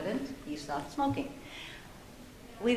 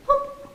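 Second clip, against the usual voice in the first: high whoop; 5 dB louder than usual. Retold or imitated. Imitating brother's eyes.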